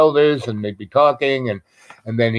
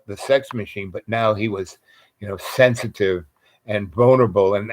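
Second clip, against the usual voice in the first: ordinary talk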